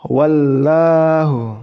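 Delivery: wallahu, slow, with a wrongly drawn-out sound; wrong way to recite wallahu; wrong reading. Shown as a demonstration. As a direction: demo the mistaken recitation of wallahu.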